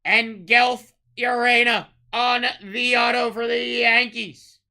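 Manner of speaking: loud, slowed card call; hype call. Real, not acted. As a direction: announce the pull with a lift.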